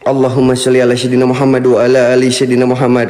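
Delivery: low, fast prayer under the breath; fast muttered prayer. Real, not acted. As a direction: rattle off the sholawat quickly and low. Mutters a prayer against ghosts. (doa cepat bergumam pelan)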